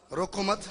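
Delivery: रोको मत as one unit, rising on मत; pause after मत. Shown as a demonstration. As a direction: join रोको मत and stop there. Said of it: "लहजे से बिल्कुल ही". ("रोको मत" एक साथ, फिर ठहराव)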